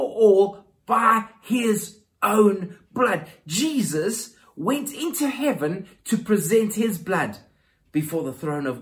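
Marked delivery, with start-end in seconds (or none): 0.0-3.3 slow, much louder drawn-out proclamation; emphatic climax. Acted. Preacher proclaiming Christ's own blood, slow and loud. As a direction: drive home his own blood.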